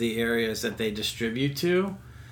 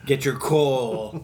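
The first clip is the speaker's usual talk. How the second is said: slow, teasing Krampus warning; a hint of spooky Christmas warning; mock ominous; 2 semitones higher, much slower than usual. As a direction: drag it out like a threat. Joking about naughty Krampus.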